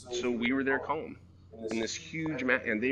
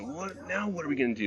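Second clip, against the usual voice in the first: higher-pitched exasperated demand; mock exploding; 5 semitones higher than usual. Boss who could have exploded at his worker, mildly exasperated. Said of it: "I could have exploded and said".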